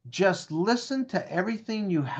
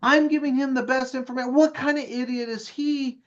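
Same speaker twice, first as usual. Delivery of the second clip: smug indignation; 7 semitones higher than usual. Retold or imitated. Narrator's smug inner voice.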